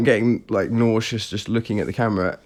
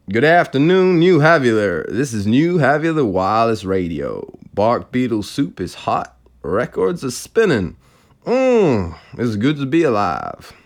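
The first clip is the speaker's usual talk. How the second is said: jovial American radio presenter, hammed up; bad American accent. Radio presenter greeting New Haviler listeners, very bad American accent. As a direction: swing the pitch up and down in a cheesy American DJ accent. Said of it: "very bad American accent".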